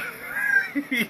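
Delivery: squeaky high laugh; quick high-pitched giggle. Real, not acted. Laugh at own joke.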